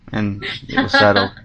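ordinary talk

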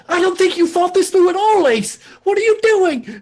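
very high, loud squawking rebuke; scolding Stiffy. Acted. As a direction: scold in a piercingly high character voice. Stiffy scolds while hitting Ace.

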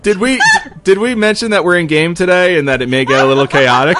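loud, high, laughing through words. Real, not acted. Laughing through in-game chaos.

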